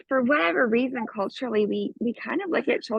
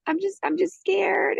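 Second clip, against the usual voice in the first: lightly voiced small child, pitched higher, slower; scared little girl; 10 semitones higher than usual. The five-year-old admits to her mother she is scared, a light childlike voice. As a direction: speak like a frightened child clinging to mom. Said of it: "she's like".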